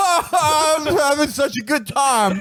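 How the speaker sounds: high, drawn-out hysterical fake cheer; hammed-up wail pretending to have a great time; mock cry-laughing. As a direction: shriek it slowly through pretend tears of laughter. Voicing a cry-laughing emoji post.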